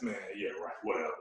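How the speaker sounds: quiet, dismissive "yeah right"; sceptical aside. Retold or imitated. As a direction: brush it off under the breath. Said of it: "I took that as".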